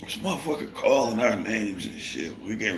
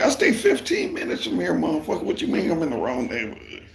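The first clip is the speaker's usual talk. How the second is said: much higher, louder indignant comeback; outraged retort; 11 semitones higher, 4 dB louder than usual. Retold or imitated. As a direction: throw his words back at him. Angry comeback to boss.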